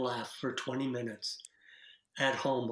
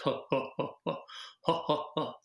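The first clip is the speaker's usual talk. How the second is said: forced, separate ha-ha-has; acted fake laugh; faster than usual. Shown as a demonstration. Laughter coach imitating hesitant group members, acted laugh. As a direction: fake a laugh, one syllable at a time. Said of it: "like acting. They're like".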